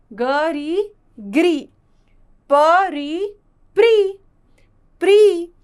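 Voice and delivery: letter, then "री", then blended "क्री", "ख्री", loud and drawn out; syllable blending demo. Shown as a demonstration. Teacher showing students how री joins each consonant, pronunciation demo. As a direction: sound out "ग री", then join it firmly as "ग्री". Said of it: "उसका उच्चारण हो जाता है".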